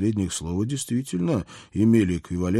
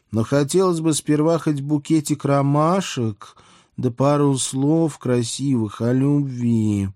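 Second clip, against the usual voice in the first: high, drawn-out mock moan; moaning princess; 6 semitones higher, slower than usual. A narrator's reading. Princess pinned under the narrator, moaning in a high voice. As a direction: moan it out slowly in a higher voice. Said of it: "простонала лежащая подо мной Василиса".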